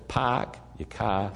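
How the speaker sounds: slow, soft Boston "Park your car"; practicing Boston accent. Retold or imitated. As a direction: try out a Boston accent quietly to yourself. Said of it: "I practiced on, on the airplane".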